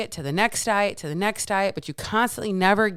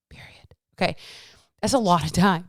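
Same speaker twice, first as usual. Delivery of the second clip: usual speaking voice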